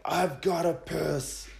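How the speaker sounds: lightly voiced drunk friend; urgent drunk. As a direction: drag it out half-asleep. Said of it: "Idol wakes up again and is like".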